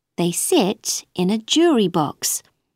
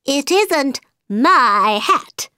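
high, slow hatter; high nervous hatter; Mad Hatter voice; 6 semitones higher, 4 dB louder, slower than usual. A narrator's reading.

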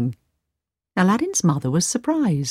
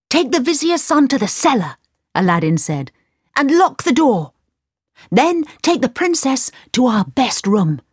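commanding Aladdin; 7 semitones higher than usual. Acted.

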